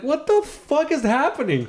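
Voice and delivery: high, drawn-out disbelief; baffled outburst. Retold or imitated. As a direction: retell it in stretched, high-pitched bewilderment. Retelling his baffled reaction to cop.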